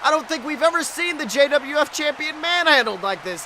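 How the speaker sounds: ordinary talk, nothing marked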